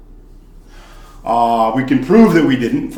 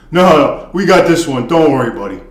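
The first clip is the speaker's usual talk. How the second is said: cocky frat bro bravado; 2 semitones higher, 6 dB louder than usual. Retold or imitated. Frat boys brush him off.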